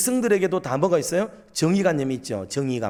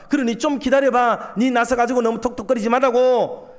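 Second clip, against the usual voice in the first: higher, insistent mild scolding; exasperated telling-off; 6 semitones higher, 4 dB louder than usual. Acted. God telling the prophet to wait, firmly. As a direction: rein in the prophet, pitch lifted.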